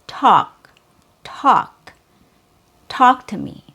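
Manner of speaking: "talk" with a popped, released T; true English T. Shown as a demonstration. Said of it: "let's use our words in some short sentences".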